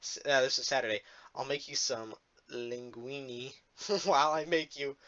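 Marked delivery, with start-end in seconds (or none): 1.3-5.0 slow, hushed, much lower mock-seductive read; low, murmured message reading. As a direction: drop low and soft, drawing out the words. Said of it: "I'm gonna read off some Gooby".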